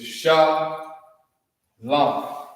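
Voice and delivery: Shah drawn out slowly; slow syllable demo. Shown as a demonstration. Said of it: "What comes next?".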